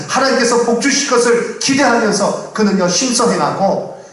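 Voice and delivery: high, insistent pulpit voice; impassioned preaching. Real, not acted. Pastor defending Nehemiah's sincere hope for God's reward, impassioned preaching. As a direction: press the explanation home with fervour.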